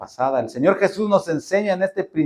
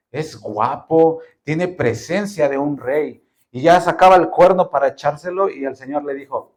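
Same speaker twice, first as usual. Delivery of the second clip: ordinary talk, nothing marked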